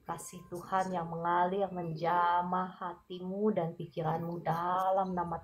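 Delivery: soft, tender prayer against fear; calm the worried one softly as you pray; gentle prayer. Acted. Prayer for an anxious viewer. (doa lembut yang menenangkan)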